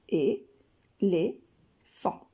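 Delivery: Et, les, fan said as three separate beats; split into syllables. Shown as a demonstration. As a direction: chop Éléphant into three slow beats. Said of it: "trois syllabes, trois arcs de cercle".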